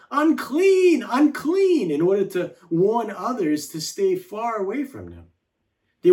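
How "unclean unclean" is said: loud, high-pitched cry of warning; yelled warning. Acted. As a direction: call it out to keep people far away. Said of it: "they would have to yell out".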